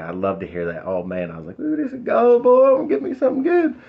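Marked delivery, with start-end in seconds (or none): 1.6-3.8 mock excited. Mock-excited at the junk bike.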